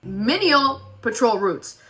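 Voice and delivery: menial said slowly, syllable by syllable; slow careful reading. Real, not acted. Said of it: "I need to go back to school".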